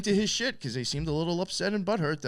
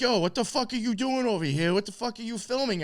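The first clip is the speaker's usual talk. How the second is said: higher-pitched retold challenge; mock a guy demanding answers, voice raised in pitch; confrontational; 8 semitones higher than usual. Kid confronts him over the drone filming, confrontational.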